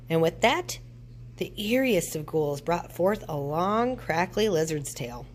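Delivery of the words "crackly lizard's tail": plain reading voice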